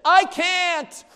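high, full-volume holler; imitated yell back. Evangelist answering the cursing driver, imitated loud yell. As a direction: yell the retort right back. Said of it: "He yelled back to the guy".

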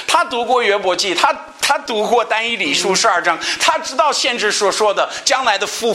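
high, earnest voicing of Martha; a sermon voicing of a believer's words; fervent preaching. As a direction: lift it with eager faith.

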